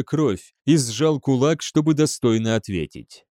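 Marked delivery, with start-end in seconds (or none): none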